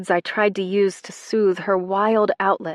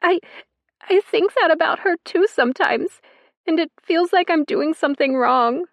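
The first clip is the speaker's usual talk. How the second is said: hiccuping through tears; 10 semitones higher, 5 dB louder than usual. Acted. Girl defending her mother through tears, high and tearful. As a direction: catch on the first word, crying. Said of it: "voice hiccuping around her tears".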